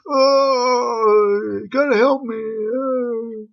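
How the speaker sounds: hammed-up desperate kid begging; whiny begging kid. Stuck kid begs the group to push his car, mock whiny.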